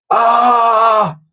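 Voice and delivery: high, loud frightened scream. A narrator's reading. Two friends plunged into darkness, screaming. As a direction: shriek in the dark. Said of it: "they screamed".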